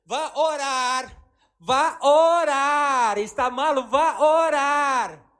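slow, very loud commanding shout; booming divine order, drawn out; God's booming command. Retold or imitated. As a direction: thunder it out like God insisting. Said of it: "a Deus habla assim".

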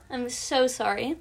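coughing from allergies; cough, then apologize. Real, not acted. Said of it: "My allergies are acting up".

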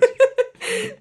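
high, loud laughing burst; loud burst of laughter. Real, not acted.

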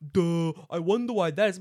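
high, loud mocking "duh" voice; imitating everyone, exaggerated and sarcastic; mock obvious. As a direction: put on a loud, dopey know-it-all voice. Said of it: "everyone goes".

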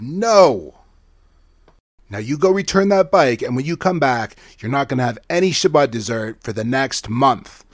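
loud stern mama laying down punishment; a firm telling-off from mum; mother punishing her son, loud and stern; scolding mother. Acted.

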